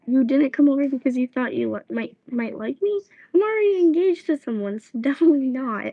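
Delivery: loud, high, exasperated scolding; angry girl. Retold or imitated. Girl scolds the boy who pestered her, loud and exasperated. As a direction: tell him off, then laugh at him. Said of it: "And he said".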